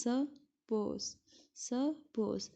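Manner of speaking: ordinary talk, nothing marked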